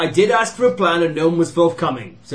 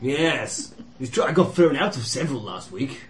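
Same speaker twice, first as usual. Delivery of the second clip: normal talking voice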